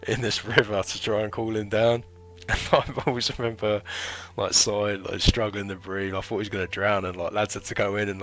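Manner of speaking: loud storytelling breaking into laughs; amused, laughing. Real, not acted. Marine recalling his mate thrown in a river, laughing loudly. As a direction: tell it loudly through bursts of real laughter.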